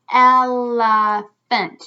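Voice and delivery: Elephant very slow and loud, in three beats; syllable by syllable. Shown as a demonstration. Teacher counting syllables for children, slow and loud. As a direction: stretch out el, uh, phant.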